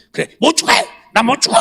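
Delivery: mock stubborn refusal.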